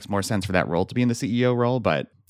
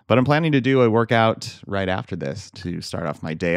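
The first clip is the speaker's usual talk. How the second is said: ordinary delivery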